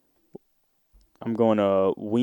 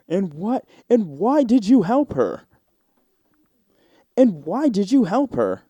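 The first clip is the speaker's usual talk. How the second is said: higher, louder polished host voice; mock a slick TV presenter; imitating a smooth TV host; 7 semitones higher, 4 dB louder than usual. Host asking the bystander, in a polished TV voice. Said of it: "T V friendly voice".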